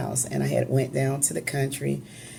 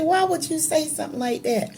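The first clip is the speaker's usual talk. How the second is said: retold, much higher; shocked question; 12 semitones higher, faster than usual. Daughter startled by father's grim remark, shocked. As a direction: ask in disbelief at his words. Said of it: "I said".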